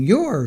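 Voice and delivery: "You're" pitched high and falling; stress on YOU'RE. Shown as a demonstration. YouTuber pointing out whom the defendant addressed, stressed "You're". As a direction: lift "You're" sharply in pitch to single it out.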